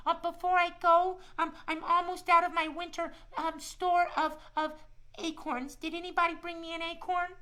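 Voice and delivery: put-on a friendly shy squirrel talking to kids; squirrel puppet voice. Squirrel puppet Shiloh tells the kids about winter, high cheerful voice.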